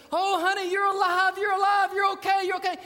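high, loud cries of relief; overjoyed cry. Retold or imitated. Father grabbing his daughter from the sea, shouting with joy. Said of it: "and he goes".

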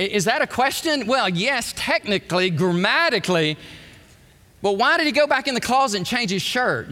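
ordinary talk, nothing marked

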